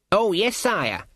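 high, flustered reply to royalty. A narrator's reading. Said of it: "almost as flustered as the king".

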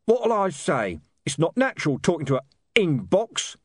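a touch of a growling heavy; damp growl. A narrator's reading. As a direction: thug Mr Tulip grumbling at the box. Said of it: "damp growl of Sister Jennifer".

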